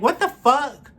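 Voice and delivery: high, loud, stunned "what the fuck"; outraged disbelief. Retold or imitated. Guest hearing the man blame his meds, outraged disbelief. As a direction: shout it in outraged shock. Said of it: "I'm the only one like".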